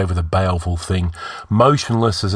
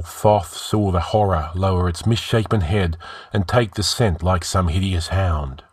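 plain reading voice

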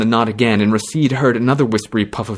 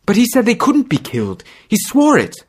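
distressed; 13 semitones higher, 4 dB louder than usual. Acted. Girl disbelieving, distressed.

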